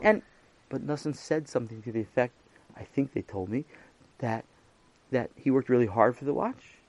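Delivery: ordinary delivery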